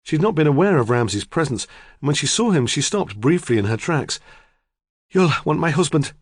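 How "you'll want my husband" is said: hurried, breathless woman on her way out. Acted. Busy farmer's wife rushing past the detective, breathless. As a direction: rush it out, a bit out of breath. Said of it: "she said breathlessly".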